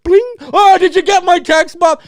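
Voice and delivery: loud high chatty phone-gabber voice; a rude theater patron calling to his friend, hammed up; mock loud texter. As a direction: ham up an obnoxious moviegoer yelling across the theater.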